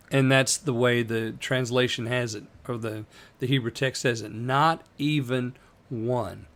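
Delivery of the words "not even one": "Not even one" slow, each word pressed; emphatic. Shown as a demonstration. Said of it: "So it's emphatic here".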